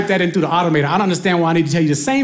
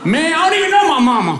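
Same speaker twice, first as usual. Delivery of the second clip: exasperated shout; 10 semitones higher than usual. Retold or imitated. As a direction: blow up at the phone agent. Teller yelling at agent.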